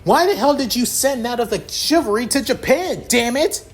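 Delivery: angry yelling. Acted. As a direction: scold him hard, near shouting. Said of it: "ticked off mark".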